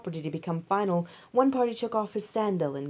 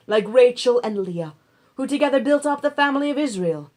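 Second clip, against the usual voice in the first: proclaimed blessing; 4 semitones higher, 7 dB louder than usual. A narrator's reading. Crowd at gate proclaims blessing.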